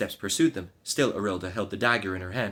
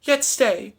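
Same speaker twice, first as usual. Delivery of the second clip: high pleading cry; 8 dB louder than usual. Acted. Maid crying out to the departing knight, high and pleading. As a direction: call out high and loud to stop him. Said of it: "she cried".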